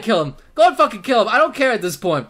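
ordinary talk, nothing marked

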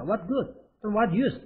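slow, word by word, like a teacher; slow model reading. Shown as a demonstration. Teacher modelling the phrase slowly for students. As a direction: spell it out slowly for the class. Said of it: "같이 씁니다".

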